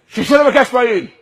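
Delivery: stern order. Acted. Roadside man to the stopped driver, stern order.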